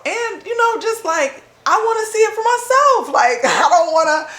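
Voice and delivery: squealing with eager excitement; excited high voice. Real, not acted. To listener about wanting to see it herself, excited squeal.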